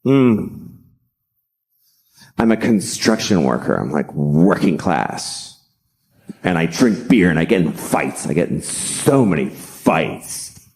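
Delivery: low, slow, swaggering tough guy; mock macho self-praise, savoring each phrase; self-impressed Matt Damon. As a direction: play a low-voiced blue-collar braggart. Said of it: "So he basically sat down. He's like".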